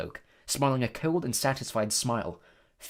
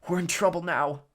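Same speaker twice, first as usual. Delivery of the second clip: high-pitched, hoarse worry; 7 semitones higher than usual. A narrator's reading. Ron reacts as the door shuts, hoarsely. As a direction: rasp it out anxiously. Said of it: "he said hoarsely".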